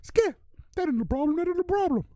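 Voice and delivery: very high squeaky cartoon voice; quoting Shannon Sharpe in a Mickey Mouse squeak. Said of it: "Here goes your Mickey Mouse".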